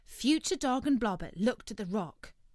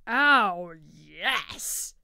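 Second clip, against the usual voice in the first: turkey voice; 10 dB louder than usual. Acted. Tick-Tock Turkey voiced in story.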